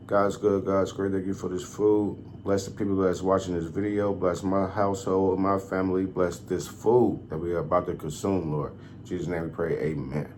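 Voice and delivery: soft, low prayer before eating; saying grace. Real, not acted. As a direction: quietly bless the food. Prays over the food.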